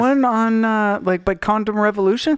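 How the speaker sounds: can't believe it closed; shocked disbelief. Real, not acted.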